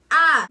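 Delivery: short, loud, high fangirl scream; reenacted excited scream. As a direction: scream on seeing a friend, replayed. Reacting to seeing her friend.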